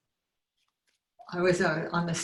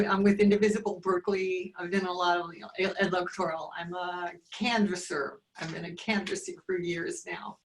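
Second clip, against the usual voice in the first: usual speaking voice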